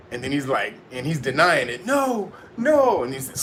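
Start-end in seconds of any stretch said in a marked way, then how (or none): 1.8-3.0 much higher pleading no. Retold or imitated. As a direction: beg it away in a high voice.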